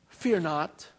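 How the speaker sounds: quiet, steady comfort; calm reassurance. Acted. Elisha calmly reassuring his frightened servant.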